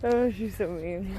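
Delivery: usual speaking voice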